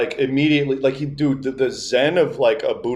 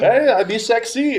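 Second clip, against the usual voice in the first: high, louder attention-seeking call; insistent grandma; 11 semitones higher, 3 dB louder than usual. Retold or imitated. Grandma retries her joke on distracted grandpa, insistent imitation. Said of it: "And then she's like".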